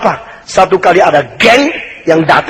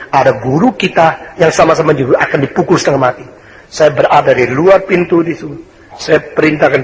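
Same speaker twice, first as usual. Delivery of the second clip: ordinary talk, nothing marked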